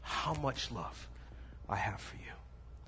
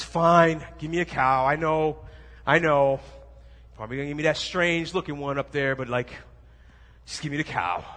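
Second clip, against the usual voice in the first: loud grumbling father; gruff dad; 8 dB louder than usual. Retold or imitated. Dad grudgingly haggling over a cow, loud. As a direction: bark the bargain like an impatient dad.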